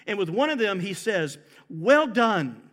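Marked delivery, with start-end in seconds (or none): 1.7-2.6 higher, louder royal commendation; exclaimed praise. Acted. King to a faithful servant, exclaiming. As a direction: exclaim it proudly as the approving king. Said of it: "the king exclaimed".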